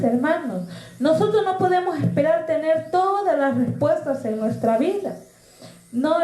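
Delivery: lifted pulpit delivery addressing the hermanos; preaching. Acted. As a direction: exhort the brothers and sisters with conviction. Preaching to the congregation.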